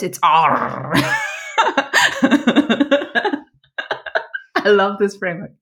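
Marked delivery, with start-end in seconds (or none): 0.0-0.9 loud, drawn-out pirate ARR; best pirate voice. Retold or imitated. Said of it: "or with my best pirate voice". 1.0-3.4 giggling at own joke; high laugh. Real, not acted.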